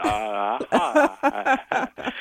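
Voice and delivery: imitating a jaw too cold to move; frozen jaw. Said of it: "I couldn't move my jaw".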